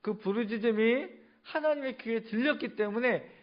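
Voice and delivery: high, loud sermon climax; impassioned preaching. Real, not acted. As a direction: proclaim it forcefully to the whole congregation. Preaches the climax at full force.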